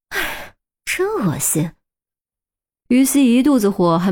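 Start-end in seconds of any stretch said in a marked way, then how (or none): 0.1-1.7 slow, soft disgusted sigh; disgusted sneer. Acted. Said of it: "忍不住冷冷讽刺了一句".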